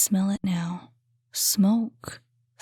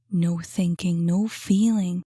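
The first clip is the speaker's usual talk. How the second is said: usual speaking voice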